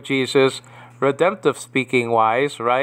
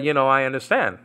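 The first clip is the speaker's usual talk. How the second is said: ordinary delivery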